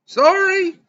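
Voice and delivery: loud, very high called-back sorry; high-pitched apology. Acted. Travis answering the barmaid's yell, high-pitched apology. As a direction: pipe up high and loud from across the room.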